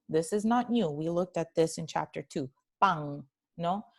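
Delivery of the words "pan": "Pan" ending in the ng of song; velar final N. Shown as a demonstration. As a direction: repeat "Pan" Andalusian-style with a back-of-mouth N. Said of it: "se pronuncia como la N de song".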